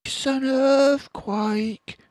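mother fox frightened in the tunnel; alarmed Missus Fox. A narrator's reading. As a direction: cry out in alarm. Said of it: "cried Missus Fox".

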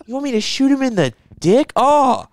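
high, slow, dopey questioning; deputy misunderstanding the headshot order; dim cop voice. Retold or imitated. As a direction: play the slow-witted deputy lightly. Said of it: "And he's like".

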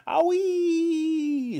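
very high, drawn-out squealing cry; hen's shriek. A narrator's reading. Hen jolted by the medicine, shrieking. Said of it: "shrieked the hen".

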